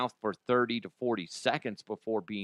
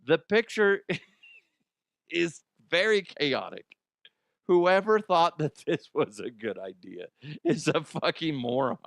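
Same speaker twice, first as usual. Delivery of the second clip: ordinary talk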